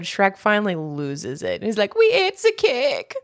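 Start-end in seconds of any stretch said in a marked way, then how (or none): 2.0-3.1 high, slow mock crying little pig; imitating a pig admitting the cake; squeaky pig voice. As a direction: whimper it like a guilty little pig. Imitating the pigs in the story.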